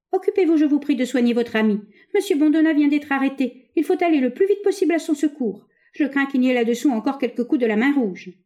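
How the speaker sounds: high-pitched, pressing instructions; urgent appeal. A narrator's reading. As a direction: urge them to hurry to the rescue. Hunchback urgently asks for help.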